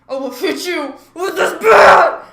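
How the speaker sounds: loud slow yelled threat; mock angry threat. Boy threatening an older kid with a bat, loud slow yell.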